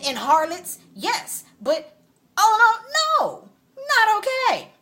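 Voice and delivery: real angry high-pitched rant; attitude. Real, not acted. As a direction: rant up to a loud high peak. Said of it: "So now I got an attitude".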